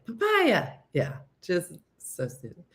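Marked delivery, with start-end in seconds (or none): 0.1-0.7 high-pitched mock yell. Shown as a demonstration. Demonstrating for listeners what to yell at Chris, mock yell. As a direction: pitch it way up like a silly call. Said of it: "just yell "papaya".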